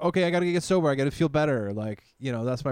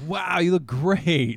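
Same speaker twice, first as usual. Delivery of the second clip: higher, slow gushing compliment; mock admiring praise; 6 semitones higher, 5 dB louder, slower than usual. Imagined strangers gushing over his new hair, slow and higher. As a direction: gush like an impressed stranger.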